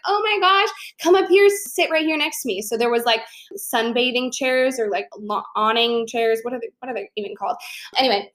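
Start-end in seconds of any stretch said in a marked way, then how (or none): 0.1-2.6 high, gushing welcome; pitched-up, delighted come-sit-here; excited invite. Retold or imitated. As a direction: squeal it like meeting a kindred spirit. New friend inviting her over.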